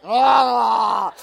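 loud drawn-out yell; imitated waking dad. Dad woken by the phone call, mock startled holler. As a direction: cry out as if jolted awake. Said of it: "you hear him wake up".

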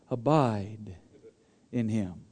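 hushed, deep intoned "Abide in him"; somber radio preacher. Retold or imitated. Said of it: "It's very somber sounding".

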